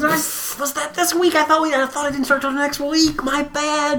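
high, put-on apologetic voice; sheepish excuse. A bumbling sitter apologizing to the parents, high and sheepish. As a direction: play dumb in a high silly voice. Voicing an excuse-making figure.

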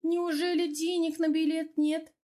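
mockingly incredulous, high register. A narrator's reading. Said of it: "насмешливо спросила соседка".

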